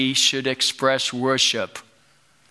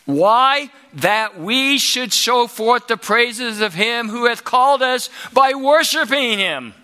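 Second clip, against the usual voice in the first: rising, higher and louder sermon build; preaching at full force; 6 semitones higher, 5 dB louder than usual. Acted. Preacher rousing the congregation, building to a loud cry. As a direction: climb to a shouting peak.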